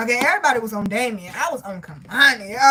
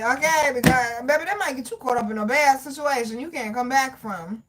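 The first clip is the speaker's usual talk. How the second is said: ordinary talk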